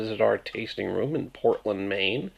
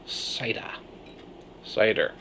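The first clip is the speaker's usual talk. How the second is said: Maine cider; 6 dB quieter than usual. Shown as a demonstration. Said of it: "it's these guys' fault".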